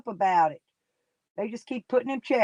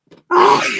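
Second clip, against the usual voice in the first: loud, harsh coughing fit; 10 semitones higher, 12 dB louder than usual. Real, not acted. Coughing on a livestream.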